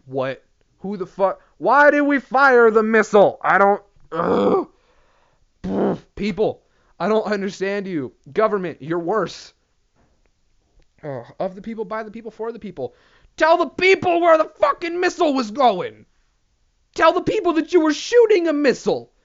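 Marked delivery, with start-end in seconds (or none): 1.6-4.7 louder, fed-up demand; exasperated shout. Real, not acted. Ranting at the government about the missile, exasperated shout. 13.4-19.1 higher, louder demand; indignant shout. Real, not acted. Demanding the government tell the people, indignant higher shout.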